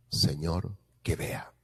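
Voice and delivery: breathy whisper of a blind man begging; whispered plea. Acted. Blind man's plea retold. (súplica susurrada)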